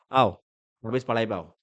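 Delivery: ordinary delivery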